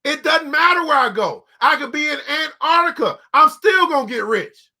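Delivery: normal talking voice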